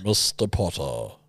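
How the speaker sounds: slow, low professor impression; Severus Snape. Friend copying the contestant's professor voice, slow and low. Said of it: "that's what he does".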